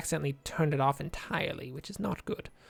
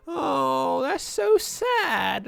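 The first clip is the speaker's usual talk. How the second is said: very high, very slow mournful fish; the fish sighs over its fate, drawn out and high; high, slow, pitiful little fish; sad fish voice; 6 semitones higher, much slower than usual. Pretend. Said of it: "the bass is like".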